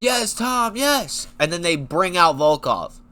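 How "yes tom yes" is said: high, lightly voiced crowd chanting yes; mock the whole arena agreeing, pitched higher; a hint of an eager crowd, much higher; crowd cheering. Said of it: "everybody would say".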